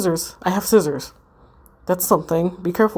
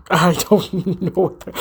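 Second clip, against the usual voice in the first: laughing; slower than usual. Real, not acted. Actor laughing mid-line.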